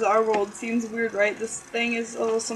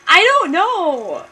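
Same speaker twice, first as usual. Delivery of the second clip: exasperated; 7 semitones higher, 8 dB louder, slower than usual. Real, not acted. Exasperated aside, laughing.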